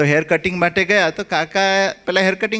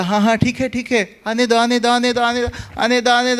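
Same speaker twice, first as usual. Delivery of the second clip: lightly voiced boy calling higher; boy guiding a car; 6 semitones higher than usual. A hint of the boy guiding the car back in the dark. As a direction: wave him back, higher and eager.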